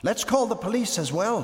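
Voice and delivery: high-pitched excited suggestion; eager boy. A narrator's reading. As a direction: pipe up eagerly like a thrilled child. Excited boy suggests more emergency calls.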